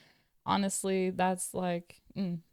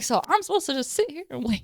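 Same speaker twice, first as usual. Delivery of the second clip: incredulous; 5 semitones higher, faster than usual. Retold or imitated. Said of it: "I'm like".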